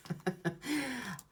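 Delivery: high giggle; real laugh. Real, not acted. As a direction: giggle at your own singing. Laughs at own singing.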